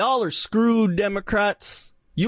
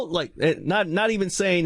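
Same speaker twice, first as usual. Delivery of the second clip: usual speaking voice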